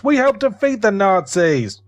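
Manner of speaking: hammed-up patriotic outrage, drawn out; mock American protest. Mocking Americans insisting on their war record, in a hammed-up indignant voice.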